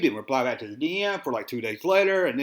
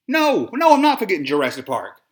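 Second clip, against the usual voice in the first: loud, much higher no, no; emphatic denial; 3 semitones higher, 7 dB louder than usual. Real, not acted. Shutting down fans who say he forgot Jurassic Park, loud and high. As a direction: cut them off with a sharp burst.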